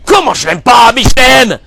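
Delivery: high, loud indignant cry; outraged protest. Acted. A father insisting to the rabbi that he loves his son, shouting high.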